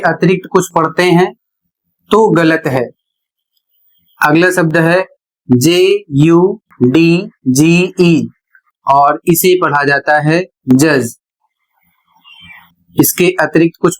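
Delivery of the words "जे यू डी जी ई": letters spelled slowly one by one; slow spelling out. Shown as a demonstration. Teacher spelling handsome slowly for the students. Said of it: "spelling out the word".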